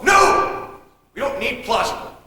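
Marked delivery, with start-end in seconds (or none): 0.0-0.7 sharp objection. Acted. Snaps back in an argument.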